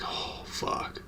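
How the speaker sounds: breathy whispered "Oh, fuck."; whispered dread. Retold or imitated. Greened out teen whispers to himself in dismay. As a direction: whisper it to yourself, realizing.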